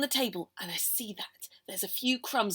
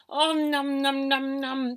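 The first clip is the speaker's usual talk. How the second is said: munching noises; 3 semitones higher, 7 dB louder, much slower than usual. Acted. Cramming cake.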